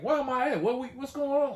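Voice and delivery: high, loud bewildered questions; just woken and lost; confused. Retold or imitated. Husband waking up, asking loudly where he is. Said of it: "I'm like".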